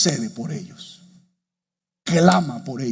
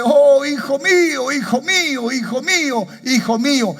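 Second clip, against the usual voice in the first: weeping lament; 10 semitones higher, 5 dB louder than usual. Acted. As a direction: wail for the lost son, then let it sink. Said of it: "David llora y dice". (lamento de padre que llora, agudo y lento)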